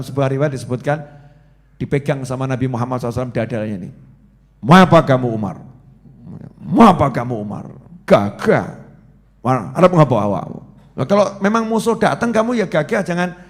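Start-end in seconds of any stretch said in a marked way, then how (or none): 4.6-10.6 loud, stern, commanding challenge; bold challenge. Acted. Prophet confronts Umar at the door, loud stern challenge. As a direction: confront him fearlessly, full voice.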